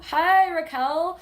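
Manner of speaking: drawn-out, high, loud hello; excited greeting. Real, not acted. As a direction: greet a friend warmly and brightly. Greeting a viewer in live chat.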